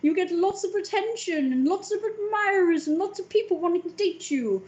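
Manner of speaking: mimicking people's advice, high and mocking; mocking everyone. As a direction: parrot what everyone says. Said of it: "Everyone's like".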